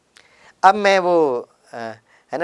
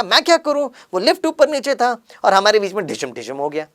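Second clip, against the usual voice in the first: raised, heated comeback; irritated retort; 6 semitones higher, 3 dB louder than usual. Retold or imitated. Annoyed passenger snaps back at the rickshaw driver, heated. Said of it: "मुझे भी थोड़ा आवेश आ गया".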